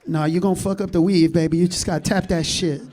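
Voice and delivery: lightly voiced mom, scolding; mom's voice. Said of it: "mom answering".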